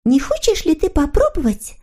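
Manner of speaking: put-on very high, sweet girlish character voice; eager little girl figure. A narrator's reading. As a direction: agree gladly in a high little voice. Snork Maiden proposes an idea. (тоненький девичий голосок)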